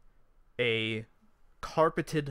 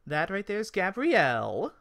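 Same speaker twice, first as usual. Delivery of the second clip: high elf voice, rising on the name; high elf hostess; Esmeralda the elf; 7 semitones higher, 4 dB louder than usual. Acted. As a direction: present her in a high-pitched voice. Elf introduces the women.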